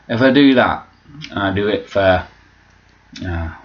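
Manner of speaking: ordinary talk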